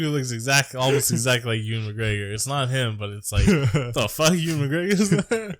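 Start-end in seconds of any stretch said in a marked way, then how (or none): 3.5-5.6 mock surprise. Double take spotting actor in crowd.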